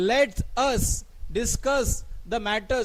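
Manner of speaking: very slow, each word spaced; slow dictation. Shown as a demonstration. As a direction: read it out word by word. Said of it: "जोर देऊन बोलण्यासाठी". (सावकाश, शब्द न शब्द)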